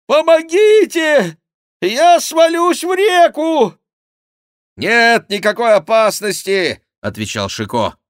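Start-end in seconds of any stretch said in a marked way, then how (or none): 0.0-3.8 high, loud, drawn-out panic; monk's cry for help. A narrator's reading. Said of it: "кричал монах". 4.8-6.8 high, loud assurance; shouted reassurance. A narrator's reading. Chicot shouts reassurance to monk.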